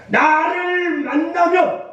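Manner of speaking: high, ringing proclamation, shouted, slowing on the end; preacher shouting God's promise to the exiles; voice of God proclaiming. Retold or imitated. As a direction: thunder out God's promise from the pulpit. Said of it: "하나님께서 말씀하시잖아요".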